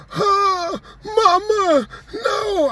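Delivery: high falsetto wail for mama; mock wailing cry. Boyfriend crying for his dead mother, in a hammed-up high wail. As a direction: wail like a grown man sobbing. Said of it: "a grown man crying".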